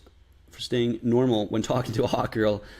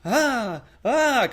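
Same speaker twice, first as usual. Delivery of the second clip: much higher ah sounds; high eager tone; 9 semitones higher than usual. Shown as a demonstration. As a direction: pitch up like trying too hard. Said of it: "versus this".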